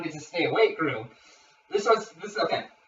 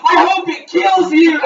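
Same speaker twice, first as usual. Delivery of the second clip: high, full-volume mock shout; hammed-up spiteful shout; retold yell; 9 semitones higher, 13 dB louder than usual. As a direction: imitate yelling at someone in bed. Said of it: "yells in the bed".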